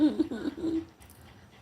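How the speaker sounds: quick amused laugh; light real laugh; short laugh. Real, not acted. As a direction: chuckle at the drama. Laughs at her being called out.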